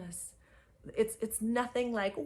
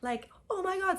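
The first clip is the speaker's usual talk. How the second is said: awed realization; 10 semitones higher than usual. Retold or imitated. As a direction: marvel at the moment. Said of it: "I was like".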